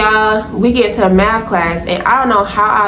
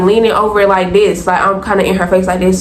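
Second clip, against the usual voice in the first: normal talking voice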